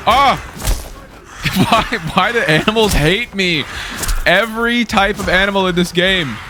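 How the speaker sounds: high-pitched yelp into a whiny protest; exasperated wail. Real, not acted. Player attacked by animals in the game, exasperated wail.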